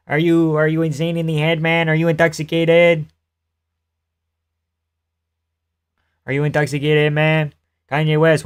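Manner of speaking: put-on Quebec French accent, lightly done; GSP impression. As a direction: mimic the rhythm of a French-Canadian fighter. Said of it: "Watch Norm Macdonald do his Quebec impression".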